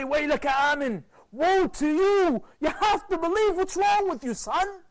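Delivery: high, loud anguished plea; cry of love. Acted. Parents pleading with their straying son, a loud high cry of love. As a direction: cry out to the son in loving desperation. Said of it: "This is a cry of love".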